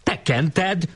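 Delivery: acted indignant question, pitch leaping up; mildly outraged challenge; flushed accusation. A narrator's reading. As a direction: accuse with rising pitch, not volume. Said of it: "szólt elvörösödve Andrási".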